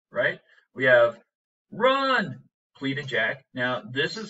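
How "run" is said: high, urgent cry; pleading shout. Shown as a demonstration. Teacher reading Jack's line as an urgent shout. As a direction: yell it at him to run. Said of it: "like screaming it at him".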